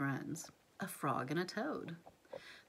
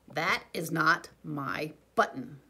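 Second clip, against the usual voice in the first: slow, louder wail, stretching "That" long; a long drawn-out complaint, louder and slow; 3 semitones lower, 8 dB louder, slower than usual. A narrator's reading. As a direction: Toad wailing that the raccoon's button isn't his. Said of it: "wailed Toad".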